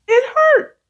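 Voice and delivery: high, loud it hurt; pained cry. Retold or imitated. Spanked child to the parent, a high loud pained cry.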